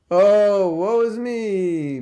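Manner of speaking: theatrical, self-pitying moan; mock lament. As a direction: draw it out in hammed-up misery. Acts out gloomy brooding thinker.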